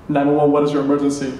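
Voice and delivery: fast, low operator monotone; 911 dispatcher. Retold or imitated. Operator answers the kids' next call, brisk and routine.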